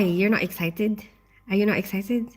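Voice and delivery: normal talking voice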